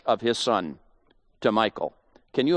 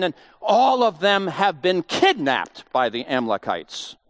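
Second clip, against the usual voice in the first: ordinary talk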